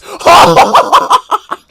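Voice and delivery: high, loud disbelieving cackle; loud screaming laugh. Real, not acted. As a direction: burst into a shrieking laugh. Screams after last-second tying goal.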